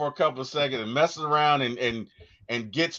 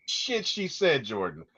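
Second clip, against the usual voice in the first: normal talking voice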